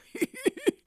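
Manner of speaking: high loud mocking laugh; villain laugh. The thief laughs at Nancy, mocking laugh. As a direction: laugh in triumph.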